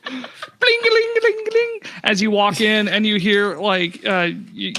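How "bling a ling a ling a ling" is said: door chime. Acted. GM voicing the door bell.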